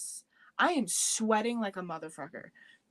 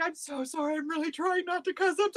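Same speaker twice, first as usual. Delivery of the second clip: retold panicked apology; 6 semitones higher, faster than usual. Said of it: "I'm, like, crying".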